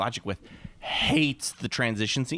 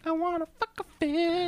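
much higher goofy falsetto; silly falsetto. Pretend. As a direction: echo it in a squeaky falsetto. Echoing joke higher.

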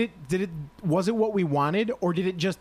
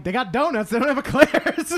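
raised, louder comic whine; mock eclair complaint; 7 semitones higher, 4 dB louder than usual. Imitating the man denied his eclair, loud high complaint. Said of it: "he's like".

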